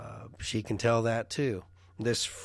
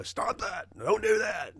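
high, sharp mock shout; a parent snapping at small children; imitated yelling at kids; 10 semitones higher than usual. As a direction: bark it like an exasperated dad. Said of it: "I'd scream at 'em".

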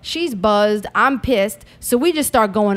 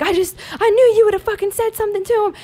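imitated protesting mom; 11 semitones higher than usual. Imitating mom explaining herself.

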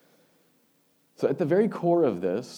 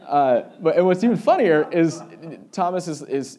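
still laughing; 7 semitones higher, 11 dB louder than usual. Real, not acted. Still laughing after the joke.

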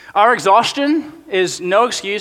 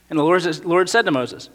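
normal talking voice

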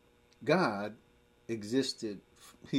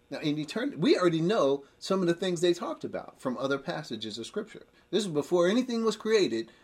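higher and louder, driving the point; fervent preaching; 6 semitones higher, 5 dB louder than usual. Acted. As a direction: preach it with rising fire. Preaching climbs again.

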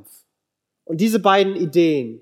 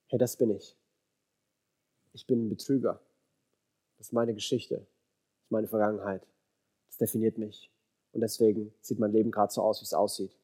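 low, hushed admission, much softer; quiet confession; 5 semitones lower, 10 dB quieter than usual. Acted. Jacob admits to God who he really is, quiet confession. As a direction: own up quietly, voice dropped low.

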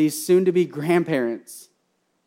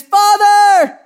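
desperate loud shout, high and long; high drawn-out call from the cross; crying out; 15 dB louder than usual. Acted. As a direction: cry out to Father at full voice. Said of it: "crying out to God the Father".

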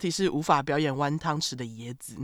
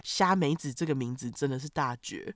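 usual speaking voice

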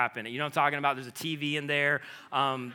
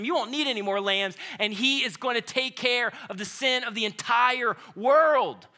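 higher, insistent prophet voice; a hint of John pleading with the crowd; voicing John; 6 semitones higher than usual.

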